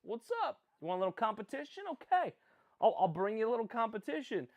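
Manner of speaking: imitating goalie Gittleman. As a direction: playfully voice the benched goalie pushing back. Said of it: "if I'm Gittleman, I'm questioning".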